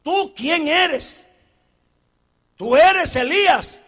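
slow, loud interrogation; demanding questioner. Acted. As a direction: press him for an answer. Acted questioning of John.

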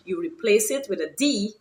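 usual speaking voice

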